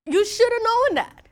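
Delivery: high, loud, indignant jab; mock accusing wife. Wife scolding husband over forgotten details, mock accusing. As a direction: scold him as if it were obvious. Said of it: "And it's like".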